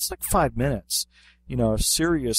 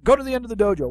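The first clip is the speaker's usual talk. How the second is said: instructor command; 10 semitones higher, much faster than usual. Retold or imitated. Instructor ordering kids down.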